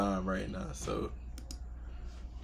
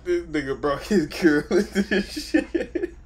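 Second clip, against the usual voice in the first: higher, talking through laughter; real laughing; 6 semitones higher than usual. Real, not acted. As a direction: crack up while getting the words out. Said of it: "I'm laughing 'cause".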